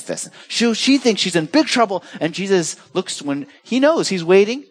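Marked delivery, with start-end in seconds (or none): none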